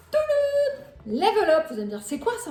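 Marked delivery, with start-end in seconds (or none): 0.1-0.9 fanfare reveal. Acted. Unveiling the product like a fanfare. 1.1-1.7 Level Up announced loud, falling, like a big unveiling; product reveal. Shown as a demonstration. Showing viewers the drink she received, loud reveal.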